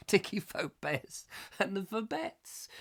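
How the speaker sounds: laughing over the act name; laughing softly. Real, not acted. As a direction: savour the silly name with a laugh. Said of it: "be [name] and the Vobettes".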